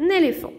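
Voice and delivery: éléphant with clear é sounds, said high; teacher modelling éléphant; model word. Shown as a demonstration. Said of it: "le son E s'écrit souvent".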